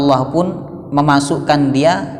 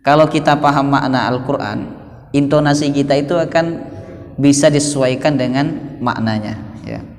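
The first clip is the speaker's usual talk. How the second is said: normal talking voice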